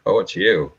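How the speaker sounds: low, flat, let-down "Oh, it's you."; unimpressed. Shown as a demonstration. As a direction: greet the caller with weary disappointment.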